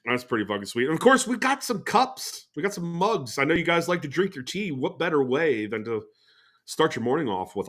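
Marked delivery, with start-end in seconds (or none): none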